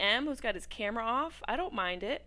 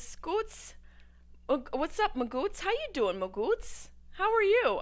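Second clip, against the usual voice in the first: much higher, sing-song reading of the message; playful chat voice; 9 semitones higher than usual. Retold or imitated. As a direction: bounce through the greeting. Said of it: "Warcraft Rabbit says".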